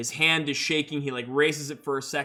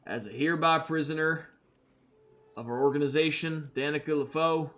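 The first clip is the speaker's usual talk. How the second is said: Red R, solemn bounty hunter. Acted. Red R claiming Danica as prisoner, solemn and cold. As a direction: pronounce the sentence with cold authority. Red R's formal arrest speech.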